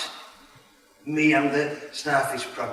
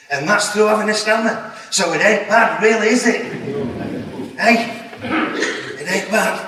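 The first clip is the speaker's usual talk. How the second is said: laughing; 6 semitones higher, 4 dB louder than usual. Real, not acted. Laughing reassurance to audience.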